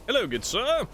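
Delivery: put-on shady Cockney accent. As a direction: play a dodgy Cockney scam caller pitching a warranty. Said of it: "Shady Cockney accent there".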